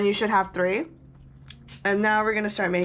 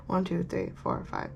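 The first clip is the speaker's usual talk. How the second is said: soft murmured counting to self; counting under breath; 9 dB quieter than usual. Real, not acted. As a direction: count quietly, fading to a whisper.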